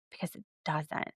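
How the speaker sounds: dropped soft and flat; muttered aside. Real, not acted. Podcaster dismissing Cody's logic under her breath.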